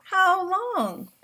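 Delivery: lightly voiced young son; small son asking. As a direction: pipe up eagerly like a little boy. Child asks papa in story.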